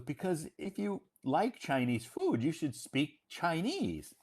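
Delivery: high-pitched, imitating an insistent chat partner; insistent retort. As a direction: retell it as an emphatic, high-pitched rule. Acted reply of chat partner insisting.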